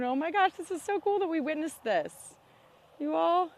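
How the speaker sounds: high, loud, thrilled voice; excited. Real, not acted. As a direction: let the excitement burst out. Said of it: "you can hear it in my voice".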